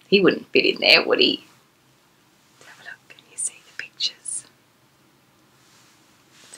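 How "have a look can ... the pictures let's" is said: hushed whisper inviting a look. Real, not acted. Fairy storyteller inviting Gemma to look at pictures, whispered.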